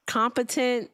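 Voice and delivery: ordinary talk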